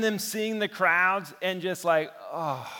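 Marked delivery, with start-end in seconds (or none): 2.3-2.7 disappointed oh. Retold or imitated. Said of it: "they can't hide their disappointment".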